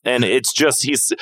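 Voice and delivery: ordinary talk, nothing marked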